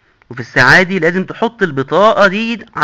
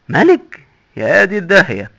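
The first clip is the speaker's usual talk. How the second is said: shocked; 3 semitones higher, slower than usual. Acted. Shocked he was a king.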